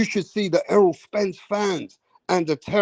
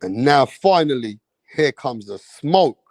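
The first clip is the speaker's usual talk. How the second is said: announcer build-up; 5 semitones lower than usual. Acted. Announcing the fight, second take.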